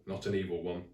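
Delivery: ordinary delivery, nothing marked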